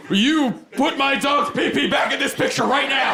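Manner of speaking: very high-pitched, hammed-up indignant yell; outraged demand. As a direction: demand it like an irate customer losing control. Acted demand at the photo studio.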